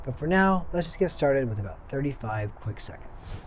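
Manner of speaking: calm, firm breathing coach; guided breathwork cues. Acted. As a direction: count them through each breath.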